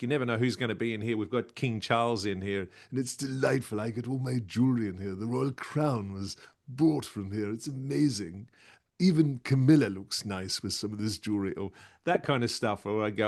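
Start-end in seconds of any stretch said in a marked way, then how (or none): 0.0-11.5 fast, jokey shopfront patter; cheeky sales banter with royal name-drops; spruiker's pitch. Shown as a demonstration. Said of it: "give us a little bit of a sample".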